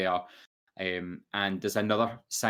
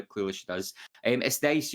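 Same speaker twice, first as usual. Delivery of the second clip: ordinary talk, nothing marked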